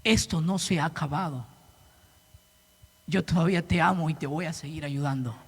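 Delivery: a hushed, loving reassurance from God; gentle divine voice. Retold or imitated. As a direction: voice God's comfort very softly. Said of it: "Dios me hizo entender algo".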